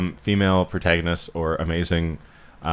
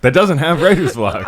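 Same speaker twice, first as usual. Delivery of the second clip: the punchline given through laughter, higher; laughing; 8 semitones higher, 4 dB louder than usual. Real, not acted. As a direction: crack up while finishing the joke. Punchline of a mock pitch.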